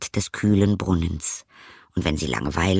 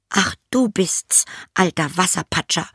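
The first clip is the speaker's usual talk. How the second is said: put-on higher, girlish princess voice; a disdainful young princess calling the frog; 10 semitones higher than usual. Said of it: "sagte sie".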